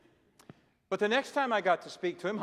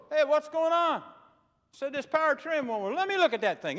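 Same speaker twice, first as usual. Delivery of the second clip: loud friendly call; 11 semitones higher, 8 dB louder than usual. Retold or imitated. Neighbor exchange over the boat.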